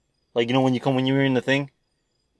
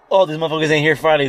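excited; 4 semitones higher, 8 dB louder than usual. Real, not acted. Excited about empty Friday.

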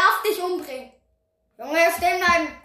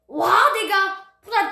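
ordinary talk, nothing marked

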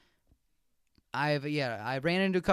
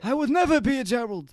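put-on higher, indignant old man voice; hammed-up old man refusal; 5 semitones higher, 4 dB louder than usual. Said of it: "very good old man accent".

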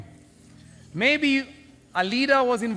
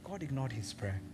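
softer, low and grave; hushed repeat; 7 semitones lower, 12 dB quieter than usual. Real, not acted. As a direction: let it sink in quietly. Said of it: "repeated softer for weight".

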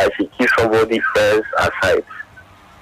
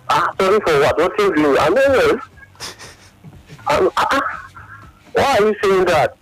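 higher-pitched, retold startled questioning; alarmed disbelief; 7 semitones higher than usual. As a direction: act out being taken aback by her apology. Acting out his alarmed reply to her.

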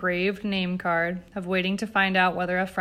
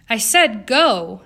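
loud, slow command; 3 semitones higher, 9 dB louder, slower than usual. A narrator's reading. Eleanor dismissing her maids, commanding. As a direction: order them out, hard and drawn out. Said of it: "Eleanor commanded".

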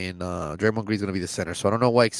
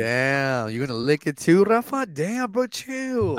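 teasing; 6 semitones higher, much slower than usual. Real, not acted. Teasing friend, pitch jumps high.

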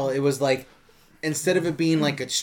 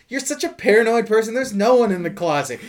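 mock dismissive wife; 7 semitones higher than usual. Wife voiced in retold movie.

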